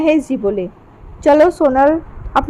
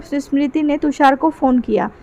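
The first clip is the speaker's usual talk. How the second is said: ordinary delivery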